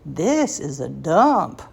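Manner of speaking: friend's Southern drawl, slow and drawn out; slow mock-Southern exclamation; imitated Southern accent. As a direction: mimic a Southern friend, stretching the words. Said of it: "in her Southern accent".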